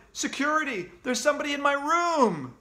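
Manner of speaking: high, loud panicked call; shouting. Acted. Kid calling security on intruders, shouting. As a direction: yell for the guards. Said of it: "I shouted".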